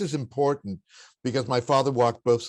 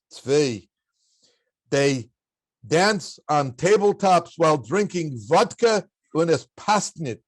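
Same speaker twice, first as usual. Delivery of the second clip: emphatic imitated Polish father, higher and louder, pausing for effect; gruff Polish accent; 5 semitones higher, 5 dB louder, slower than usual. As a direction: deliver the indictment as a gruff Polish-accented dad. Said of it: "in his gruff Polish accent".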